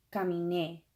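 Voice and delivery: "caminé" with the final é punched; careful demo of "caminé", weight on é; final-syllable stress. Shown as a demonstration. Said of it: "you gotta punch that last syllable".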